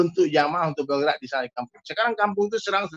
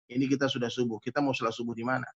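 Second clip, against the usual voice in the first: soft, lower, voiced murmur; mock whisper; 6 semitones lower, 8 dB quieter, faster than usual. Sheikh quietly asks village head about dawn prayer, mock whisper. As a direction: imitate a discreet whisper without really whispering. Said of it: "Syekh Muhammad bisikkan gini".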